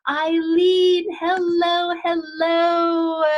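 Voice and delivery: high, drawn-out excited greeting; sing-song welcome. Real, not acted. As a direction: sing out the greeting high, stretching each word. Host calls guest onto show.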